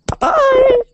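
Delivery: very high, loud squeaked "Bye-bye"; squeaky goodbye. Pretend. Host signing off to listeners in a silly squeak. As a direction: squeal a silly falsetto farewell.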